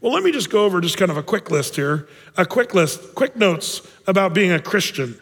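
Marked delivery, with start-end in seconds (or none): none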